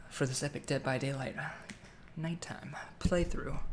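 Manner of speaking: hushed spooky whisper. Acted. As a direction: breathe it like a secret. Spooky stream intro, whispered.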